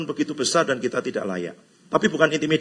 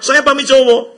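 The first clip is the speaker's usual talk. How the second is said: high and much louder mock insistence; mock chatter; 10 semitones higher, 13 dB louder than usual. Preacher mimics a noodle fan, high and loud. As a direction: announce it like a proud picky eater.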